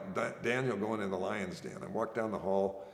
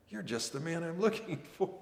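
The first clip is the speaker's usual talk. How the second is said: delighted; 7 semitones higher than usual. Retold or imitated. Sergeant suddenly delighted.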